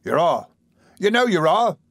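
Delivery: insist, raising pitch on the repeat; retorting. A narrator's reading. Noah retorting to Charlotte's protest, insistent. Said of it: "retorted Noah".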